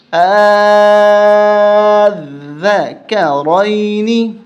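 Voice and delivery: آذکرین with the first آ held very long and loud; long drawn madd. Shown as a demonstration. Teacher demonstrates the long madd, held loud. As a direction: stretch the آ of آذکرین five counts. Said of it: "زیادہ کھینچیں گے پانچ الف تک".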